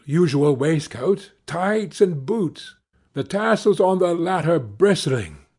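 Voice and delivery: usual speaking voice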